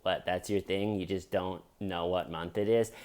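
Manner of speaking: usual speaking voice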